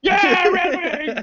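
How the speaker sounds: slow, high drunk holler, imitated; wasted fan cheer. Drunk girl in the middle of the store, mock holler. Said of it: "she was wasted".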